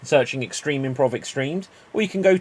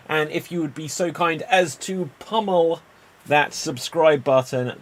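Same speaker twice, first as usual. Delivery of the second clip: ordinary talk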